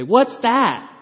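loud, high shouted reply. Retold or imitated. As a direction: blurt it out at full volume like she can't whisper. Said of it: "she's also very loud".

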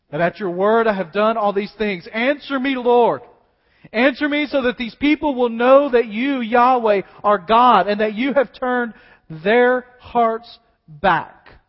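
loud pleading prayer, building; fervent preached prayer. Acted. Elijah crying out before the people, fervent prayer. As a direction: cry out to God.

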